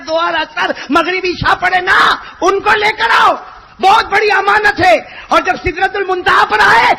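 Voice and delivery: high, loud impassioned sermon shouting; preaching at full force. Acted. Preacher thundering God's command to the crowd, full shout. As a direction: build and roar it to the congregation.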